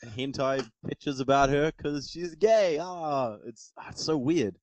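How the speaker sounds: high-pitched, hammed-up fanboy wail; freaking-out fanboy. Panelist mimics fanboys upset over a character, mock freak-out. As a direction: draw it out in mock despair. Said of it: "all these fanboys are freaking out".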